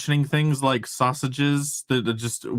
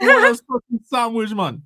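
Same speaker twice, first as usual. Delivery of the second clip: mock Jamaican accent; 9 semitones higher, 6 dB louder than usual. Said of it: "You sound like a Jamaican".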